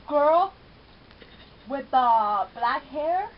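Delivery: loud call at the screen, last words slow and drawn out; shouting at the TV. Real, not acted. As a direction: yell it at the TV, stretching it out. Yelling at TV screen.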